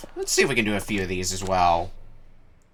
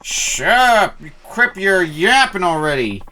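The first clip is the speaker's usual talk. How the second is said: put-on higher, belligerent drunk shouting down the kid; drunken yelling; 9 semitones higher than usual. Drunk fan yells at the player for nagging, higher and belligerent. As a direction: bark it like a sloppy drunk picking a fight.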